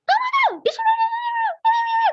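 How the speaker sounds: very high, slow, loud angry yell; mother yelling at the child angrily; angry shouting. Shown as a demonstration. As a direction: lose your temper at the child. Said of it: "itu marah-marah".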